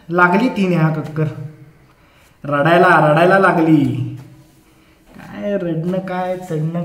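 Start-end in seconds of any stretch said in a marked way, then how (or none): none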